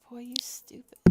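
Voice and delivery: whispered scolding to herself; self-scolding whisper. Retold or imitated. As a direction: hiss it at yourself, half-joking. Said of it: "I was like".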